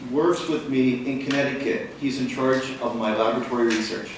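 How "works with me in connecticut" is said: ordinary talk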